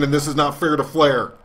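ringside commentator protesting, steady pitch; announcer outrage. Acted. Gamer as commentator defending Flair, mock outrage. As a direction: complain like a TV wrestling announcer.

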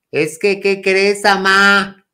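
high, drawn-out call to mom; retold calling out. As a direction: call out urgently with news for your mother. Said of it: "gritando, yo diciéndole a mi mamá".

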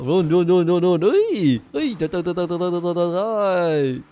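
high, rapid-fire engine noises with a yelp; vocal vroom-vroom sputter, drawn out at the end; mimicked motorbike revving. Acting out riding a motorbike overhead.

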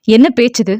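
ordinary delivery

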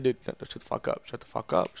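low, soft retold shut-up; telling the friend to shut up; mock hushed brush-off. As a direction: mutter him down, mock. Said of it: "I'm just like".

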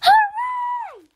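high excited shout; cheer. A narrator's reading. The whole family cheers at stopping to eat, high cheer. Everyone cheers at news of food.

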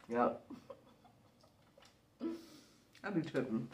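talking while chewing; muffled by food; mouth full. Real, not acted. Seen 2: Bitten burger in hand, lips closed, jaw working during speech.

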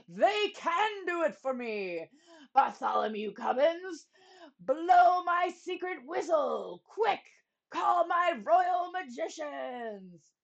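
loud, high, slow commands; shouting king. Acted. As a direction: shout orders urgently.

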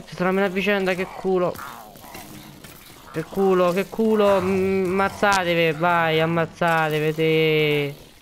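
high, gloating sing-song; gleeful cheering. Real, not acted. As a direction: egg the mobs on with glee. Gleeful as mobs fight.

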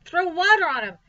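high, loud urgent call; alarmed order. A narrator's reading. Woman ordering water for fainted pig, alarmed.

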